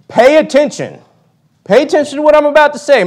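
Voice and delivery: urgent father. Retold or imitated. Voicing the father in Proverbs.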